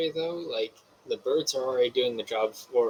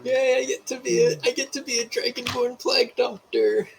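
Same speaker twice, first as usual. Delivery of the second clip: very high, excited squeal of a voice; gleeful cheer; 11 semitones higher than usual. Pretend. Player delights in becoming a plague doctor, gleeful.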